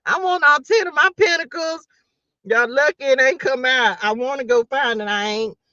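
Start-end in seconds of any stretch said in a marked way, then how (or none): 0.1-1.9 high, whiny, a touch of fake crying; mock whine. Begging for all ten pentacles, whiny and high. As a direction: beg like a spoiled child.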